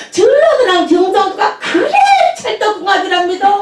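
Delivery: high, laughing announcement; a mock giddy woman; laughing delight. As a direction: beam as she reports the match. Woman's delighted quoted reply.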